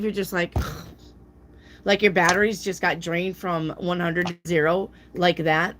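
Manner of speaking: ordinary talk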